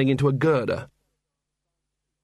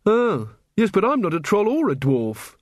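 higher, sweetly pointed reply; lightly sweet, pitched-up character voice; mock sweet; 7 semitones higher than usual. A narrator's reading. Angua answers Carrot's talk of trolls and dwarfs, sweetly. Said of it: "said Angua sweetly".

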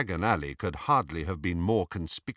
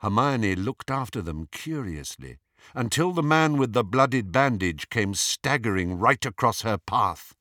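plain reading voice

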